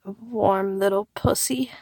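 normal talking voice

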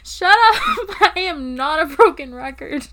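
laughing. Acted. Laughing retort.

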